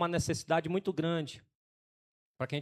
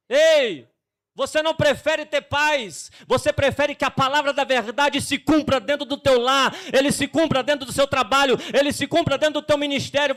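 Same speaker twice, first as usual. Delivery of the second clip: loud, higher fiery sermon to the men; preaching at full force; 7 semitones higher, 7 dB louder than usual. Acted. Pastor rebukes the men in the church, fiery preaching. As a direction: hammer the truth home from the pulpit.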